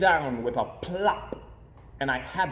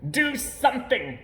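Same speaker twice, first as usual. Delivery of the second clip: high, urgent cry; panicked fish; 8 semitones higher, 5 dB louder, slower than usual. A narrator's reading. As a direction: warn them in a fright. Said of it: "said the fish".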